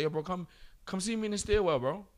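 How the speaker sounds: high but soft mock confrontation; retold snap. Teacher snapping at a student who kept talking, retold lightly. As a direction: act out snapping, without full volume. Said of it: "I just snapped".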